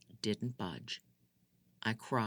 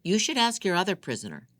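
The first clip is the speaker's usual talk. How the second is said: angry accusation; 7 semitones higher, 6 dB louder, faster than usual. Acted. Narrator voices Ahmed angry.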